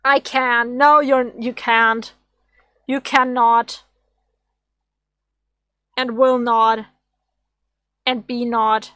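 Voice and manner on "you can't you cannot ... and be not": usual speaking voice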